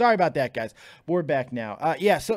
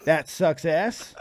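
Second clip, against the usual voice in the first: ordinary talk, nothing marked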